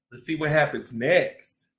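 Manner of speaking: ordinary delivery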